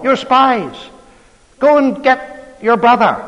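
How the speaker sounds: loud, high-pitched accusation of spying; rough and accusing. Acted. Joseph accuses his brothers, loud and rough. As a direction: bark the charge at the brothers like a hard stranger. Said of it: "spoke roughly to them".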